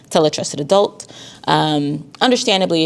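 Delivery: ordinary talk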